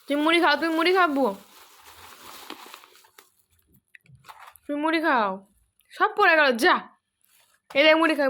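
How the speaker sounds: coaxing the animal to eat, loudly; coaxing call. Real, not acted. Coaxing the animal to take puffed rice, loud call.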